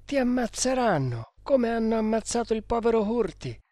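high-pitched, anxious warning; fearful servant's protest; alarmed warning. Acted. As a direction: plead with the master, voice pitched well up. Servants warn master of death.

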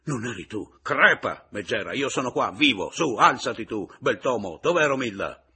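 loud, high, relentless tirade; furious yelling. A narrator's reading. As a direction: rail at them without letting up. Continues yelling at the pair.

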